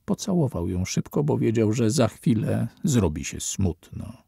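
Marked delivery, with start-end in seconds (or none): none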